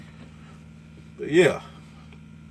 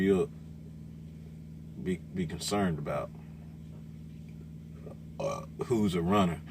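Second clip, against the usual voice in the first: ordinary talk, nothing marked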